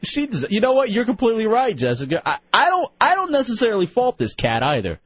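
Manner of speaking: ordinary talk, nothing marked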